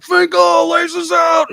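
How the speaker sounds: high, loud yell of a stranger across the room; hammed-up stadium heckle; fan calling out. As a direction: call his name out like a rowdy fan. Stranger yelling in stadium bathroom.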